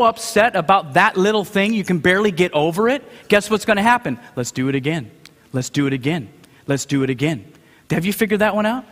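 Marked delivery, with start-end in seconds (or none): 4.3-7.4 stern coach. Retold or imitated. Coach drilling a young athlete.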